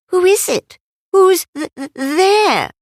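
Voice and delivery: high, stammering scared squeak; timid stuttering little voice; squeaky frightened piglet. Acted. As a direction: stutter it out like a tiny scared animal.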